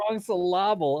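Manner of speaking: syllable mis-stressed as syl-LAH-ble; Mike Myers bit. Retold or imitated. Echoing co-host's joke, syllable said syl-LAH-ble. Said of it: "we're doing Mike Myers".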